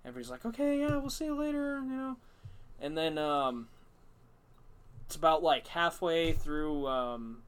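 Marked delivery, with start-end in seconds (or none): 0.5-2.2 pitched well up, breezy; high sing-song goodbye; high mock friends. Imitating friends' reply.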